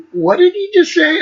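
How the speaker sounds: high-pitched puzzled outburst; baffled reaction. Acted. Young fan reacting to a lyric, baffled and high. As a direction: ask it in high disbelief.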